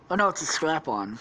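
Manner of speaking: ordinary delivery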